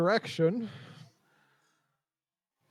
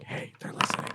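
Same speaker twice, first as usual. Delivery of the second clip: hushed unvoiced whisper; soft, breath-only aside to a companion; 6 dB quieter than usual. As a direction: whisper it like a secret tip-off. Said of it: "you could just kinda whisper like".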